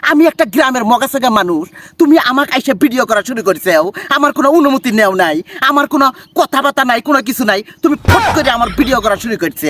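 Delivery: indignant Sirajganj villager's accent; put-on Sirajganj accent, protesting; Sirajganj dialect. Shown as a demonstration. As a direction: scold someone in broad Sirajganj dialect. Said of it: "আমি সিরাজগঞ্জের ভাষা কই".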